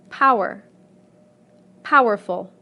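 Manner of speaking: POW-er, POW-erful; loud first syllable in Power and powerful; same stress. Shown as a demonstration. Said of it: "the stress remains the same".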